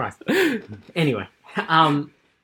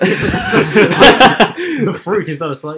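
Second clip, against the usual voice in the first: slow, laughing and much louder; 9 semitones higher, 10 dB louder, much slower than usual. Real, not acted. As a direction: laugh the words out slowly. Teasing a friend, laughing.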